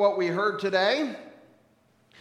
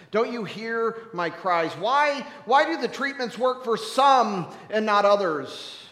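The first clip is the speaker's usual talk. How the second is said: rising, loud, pleading demand; anguished protest; 3 semitones higher, 6 dB louder than usual. Acted. Mourner to God about failed treatments, anguished. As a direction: cry out and build in force.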